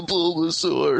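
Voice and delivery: hammed-up Pokemon sob; mock crying. Pokemon weeping over Ash's death, mock crying. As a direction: wail the name like a weeping creature, jokingly. Making fun of, like, the, probably the saddest part.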